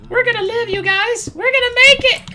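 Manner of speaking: high, loud victory shout; shrieking we're gonna live; triumphant cry. Real, not acted. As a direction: crow it out to your friends. Triumphant cry after finding mushroom cows.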